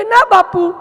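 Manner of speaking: high, loud "no sir" called back; loud denial. Acted. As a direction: shout the answer back across the house. Servant loudly denies hearing. (દૂરથી મોટેથી જવાબ આપતો નોકર)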